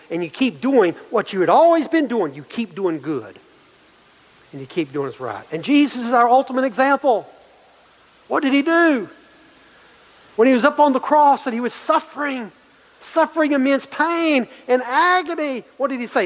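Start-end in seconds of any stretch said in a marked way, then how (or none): 5.5-16.2 preaching with a lifted pitch; fervent build to Jesus' words; rising preaching. Acted. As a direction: reach toward the cross scene, climbing. Preacher lifts voice for climax.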